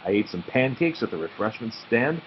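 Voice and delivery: plain reading voice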